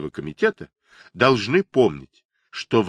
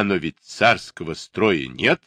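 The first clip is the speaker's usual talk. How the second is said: ordinary delivery, nothing marked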